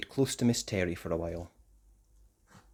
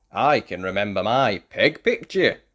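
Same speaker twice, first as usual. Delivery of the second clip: proud; 3 semitones lower, 6 dB louder than usual. A narrator's reading. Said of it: "said Tom proudly".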